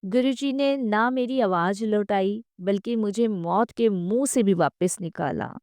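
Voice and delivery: normal talking voice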